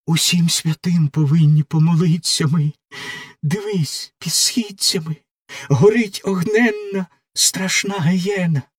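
a lightly voiced young prisoner begging for mercy; pleading doomed girl. Margarita in her cell begs the executioner, desperate pleading. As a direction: plead with the executioner for your life, raw and frightened.

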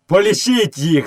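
high call with mild scorn; scornful shout. A narrator's reading. Boy taunting the villagers, scornful shout. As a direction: call out high, a touch dismissive. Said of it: "зневажливо закричав Мауглі".

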